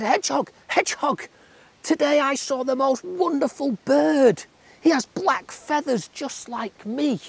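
squeaky tadpole, aghast at frogs. A narrator's reading. Tadpole excited to hedgehog.

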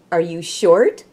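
plain reading voice

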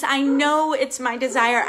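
ordinary talk, nothing marked

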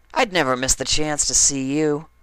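high, sincere slip of the tongue; smitten Dash. A narrator's reading. Dash blurting out her feelings to Applejack. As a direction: blurt it out fondly.

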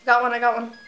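ordinary talk, nothing marked

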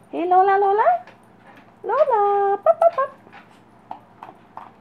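very high, loud sing-song calling to puppies; puppy calling voice. Real, not acted. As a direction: coax the pups with a squeaky high call.

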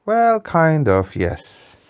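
ordinary delivery

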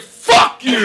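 very loud, high yell; reenacted furious shout; shouted retort. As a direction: scream it back at the heckler.

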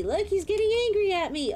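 rising alarm at the monster. Real, not acted. Panic at angry monster.

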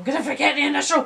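mock panic with a laugh. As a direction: rush it out, laughing at yourself. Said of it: "I'm like".

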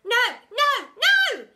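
put-on very high, loud squealing kid; excited call. A narrator's reading. Pupil calling out from the crowd, very high. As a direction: call out gleefully. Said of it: "someone called from the middle".

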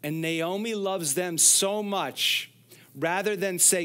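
usual speaking voice

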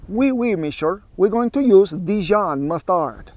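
a lightly hammed-up French accent, slowed; mock French accent. Cook redoing the mustard line in a mock French accent. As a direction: play a cheesy French chef with a French accent. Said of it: "Let's do French".

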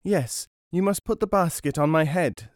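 high-voiced obliging boy; little boy. A narrator's reading. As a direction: answer mother as a small child. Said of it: "said the boy".

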